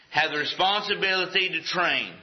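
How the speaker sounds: usual speaking voice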